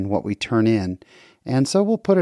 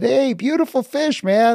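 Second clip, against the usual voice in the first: calling out across the river; 12 semitones higher than usual. Retold or imitated.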